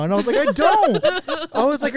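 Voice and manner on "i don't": high, loud retold protest; exasperated reply. Baffled by the friend's answer, exasperated. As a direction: burst out in raised-pitch exasperation.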